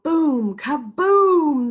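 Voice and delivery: loud, high thunder boom. A narrator's reading. Reading to kids, booming the thunder loud. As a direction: belt out the thunder like a crash. Thunder sound effect in story.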